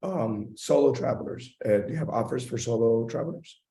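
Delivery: ordinary talk, nothing marked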